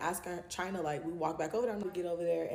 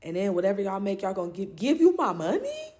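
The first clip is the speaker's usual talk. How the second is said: rising outraged disbelief; incredulous; 3 semitones higher, 8 dB louder than usual. Retold or imitated. Incredulous at giving money.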